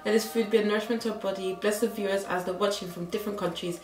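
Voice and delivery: soft, hushed mealtime prayer; quiet prayer. Real, not acted. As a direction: pray quietly over the food. Prays before the food.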